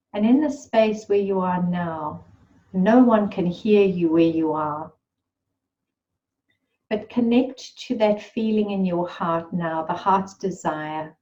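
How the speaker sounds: normal talking voice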